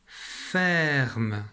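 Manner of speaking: "ferme" very slow and drawn out; mot étiré. Shown as a demonstration. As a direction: stretch "ferme" so each sound is heard. Teacher sounds out word being read. (« ferme » lentement, en étirant les sons)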